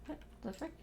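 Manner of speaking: puzzled under the breath; baffled. Real, not acted. Confused by the game, high and soft.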